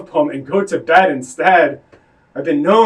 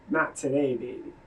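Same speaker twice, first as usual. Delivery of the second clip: hushed; 4 semitones lower, 12 dB quieter than usual. Acted. Lover's soft refusal, fading.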